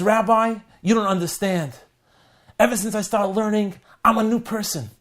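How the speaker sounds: excited insistence. Acted. Joe insists he has changed.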